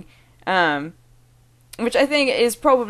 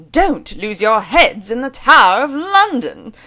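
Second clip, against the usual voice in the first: loud, higher, exaggerated posh British pitch glide; mock Doctor Stern; 5 semitones higher, 8 dB louder than usual. Vlogger imitating the accent tape's sentence, hammed-up British glide. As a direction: swoop the pitch like a pompous accent teacher. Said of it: "repeat the way that he says these sentences".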